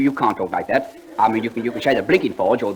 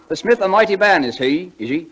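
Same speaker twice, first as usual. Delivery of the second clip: poem recital; 6 semitones higher, 3 dB louder than usual. Acted. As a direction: declaim each line like a school prize reciter. Declaims the poem.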